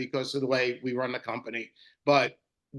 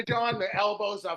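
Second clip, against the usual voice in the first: normal talking voice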